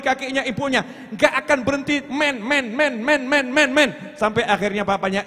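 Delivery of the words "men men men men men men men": a hint of a child pleading; lightly voiced begging kid; whining child.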